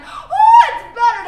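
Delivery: high "Oh" burst, then a shouted line; shouted quote. Acted. Girl with doe eyes crying out to her abuser, shouting. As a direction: fling the words out as a cry. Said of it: "and said".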